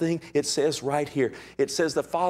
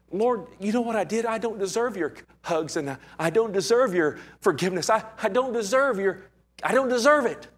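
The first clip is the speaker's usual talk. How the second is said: unworthy plea; 8 semitones higher than usual. Retold or imitated. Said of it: "I'm gonna say".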